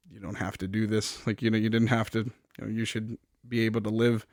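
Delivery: low and soft, imitating a wounded man; hushed retold plea; weak, quiet reply. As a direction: murmur it with little strength. Wounded hero answers his attacker.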